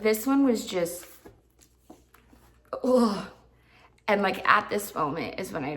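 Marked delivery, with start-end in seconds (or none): 2.8-3.3 exasperated "ugh" at a creepy book; disgusted groan. Real, not acted. Ranting about the creepy hero, disgusted groan. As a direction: let out a fed-up groan.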